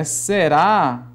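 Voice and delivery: "será" stretched slowly; slow demonstrative "será"; drawn-out example word. Shown as a demonstration. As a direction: lengthen the final vowel of "será". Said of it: "Se fosse, por exemplo".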